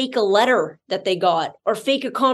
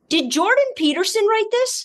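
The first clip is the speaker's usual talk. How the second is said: pitched up high in mock disbelief; a higher, sarcastic did-someone-really-write-this; incredulous scoff; 9 semitones higher than usual. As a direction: ask it like you can't believe your ears. Mocking own reaction to film.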